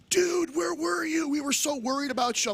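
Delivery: worried friends. Retold or imitated. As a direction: imitate worried pals, pitched well up. Friends' worried greeting in retold story.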